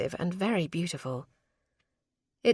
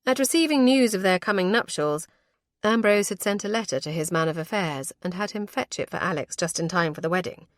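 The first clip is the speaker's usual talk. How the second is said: plain reading voice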